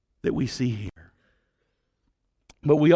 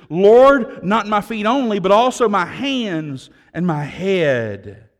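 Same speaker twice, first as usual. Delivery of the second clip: eager plea; 5 semitones higher, 6 dB louder, slower than usual. Acted. Peter begging for more washing.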